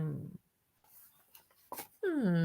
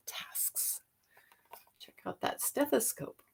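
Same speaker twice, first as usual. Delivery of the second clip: whisper; 2 semitones higher, 7 dB quieter, slower than usual. Acted. Teacher reading to kids.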